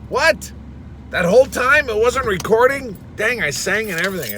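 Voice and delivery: high, loud, disbelieving cry; shocked dismay. Real, not acted. Discovers it wasn't recording.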